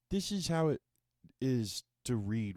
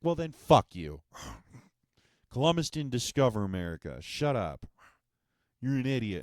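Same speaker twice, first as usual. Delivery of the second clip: louder, higher mock rant at the teacher; mock angry outburst; 4 semitones higher, 7 dB louder than usual. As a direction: blow up at them, half joking.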